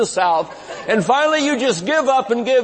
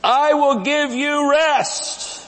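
slow scripture quote; 7 semitones higher, much slower than usual. Acted. Quoting Jesus, slow.

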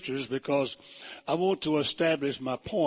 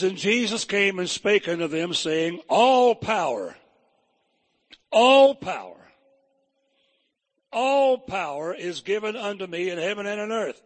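all power cried out louder each time; preaching scripture; 3 semitones higher, 4 dB louder than usual. Acted. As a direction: proclaim it like a preacher, rising. Preacher reads scripture with force.

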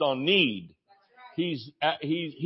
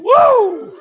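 a high, loud joyful whoop; 12 dB louder than usual. Real, not acted. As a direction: let out a big celebratory woo. Preacher whoops after song line.

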